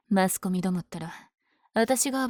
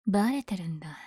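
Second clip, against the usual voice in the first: normal talking voice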